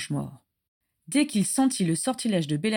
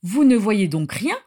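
louder, rising in protest; indignant outcry; 7 dB louder than usual. A narrator's reading. Regulus protesting to the Death Eaters, loud and indignant. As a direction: protest hotly against them all. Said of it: "s'insurgea-t-il".

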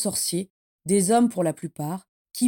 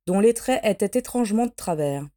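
usual speaking voice

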